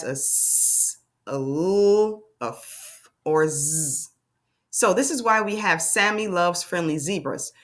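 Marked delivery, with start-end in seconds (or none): 0.0-4.1 "s", "l", "f", "z" as long drawn-out sounds; letter sounds. Shown as a demonstration. Teacher showing word-ending sounds, letter sounds held out. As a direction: hold each sound "s", "l", "f", "z" alone, not its letter name. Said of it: "the sound at the end".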